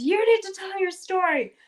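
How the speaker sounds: high, loud mock boom; booming magic voice. Kyrian booming at the queen in court, mock loud. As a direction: call out to the queen like a magnified voice. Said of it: "makes his voice really loud and booming".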